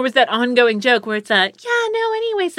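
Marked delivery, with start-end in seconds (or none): 1.5-2.6 high, airy-light fake actress voice; pitched way up, mock affected chatter; put-on voice. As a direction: imitate a woman putting on a much higher voice. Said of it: "she's doing a voice".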